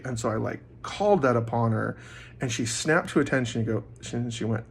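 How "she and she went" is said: ordinary talk, nothing marked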